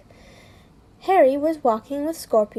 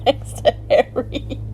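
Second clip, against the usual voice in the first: reading through a real laughing fit; helpless laughter; 3 semitones higher, slower than usual. Real, not acted. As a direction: keep reading while cracking up, words breaking apart. Reader laughs helplessly while reading.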